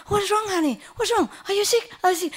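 high, anxious fussing over a pet; worried. Retold or imitated. Owner fretting over her crying dog, high and anxious. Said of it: "and then I worry".

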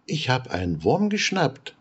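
a duckling showing off its catch. A narrator's reading. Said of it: "sagte das Entlein".

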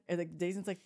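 ordinary talk

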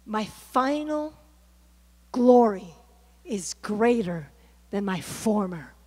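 slow, low, hushed and solemn; voice of God. Retold or imitated. Preacher retelling what God told her, hushed and slow. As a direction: let each phrase fall slowly, like a quiet revelation. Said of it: "God said to me very clearly".

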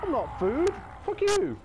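high, loud yell at the orc; panicked shout. Real, not acted. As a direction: scream it back in fear. Shouting back at attacking orc.

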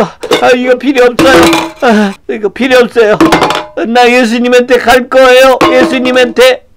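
high, breathless excitement; hurry, hopeful and high-pitched; eager blind man. Acted. Blind man throwing everything off to run to Jesus, eager.

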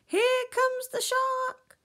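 slow, high, loud shriek; very high, loud wail of terror; terrified cry. Acted. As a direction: draw out a terrified shriek of alarm. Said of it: "The turtles were terrified".

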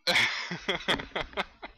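real laughing fit; exasperated laughter. Real, not acted. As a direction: laugh it off in defeat. Laughing after groan at game.

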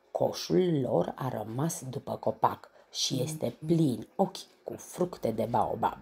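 usual speaking voice

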